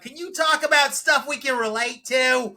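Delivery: high, loud imitation of a heckling old lady; loud, high-pitched mock heckle; yelling old lady. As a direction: yell it out like an old woman in the crowd. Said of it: "an old lady yelled out".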